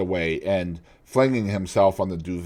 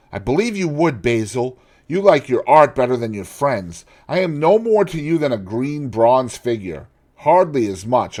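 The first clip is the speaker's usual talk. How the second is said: angry reproach; 5 semitones higher, 5 dB louder than usual. A narrator's reading. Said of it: "He seemed quite angry".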